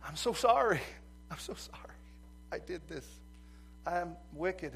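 mock bawling. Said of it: "he's just bawls".

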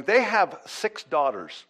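ordinary talk, nothing marked

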